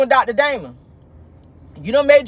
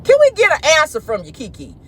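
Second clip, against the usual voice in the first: exasperated; 5 semitones higher than usual. Retold or imitated. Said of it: "I said".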